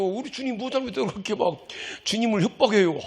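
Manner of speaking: usual speaking voice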